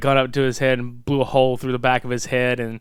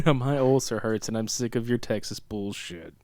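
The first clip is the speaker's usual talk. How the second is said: Ben Stein deadpan; 4 semitones lower, 8 dB quieter than usual. Retold or imitated. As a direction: quietly drone the complaint like an unemotional actor. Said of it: "sound like Ben Stein when I talk".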